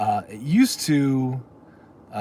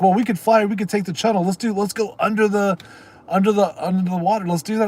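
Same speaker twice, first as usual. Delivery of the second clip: higher-pitched, eager buddy pitching an adventure; super excited; 6 semitones higher than usual. Retold or imitated. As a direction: imitate an excited friend urging everyone on. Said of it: "was super excited about it".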